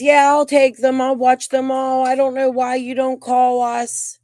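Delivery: high, loud, sing-song mock of the other grandma. Mocking the other grandma again.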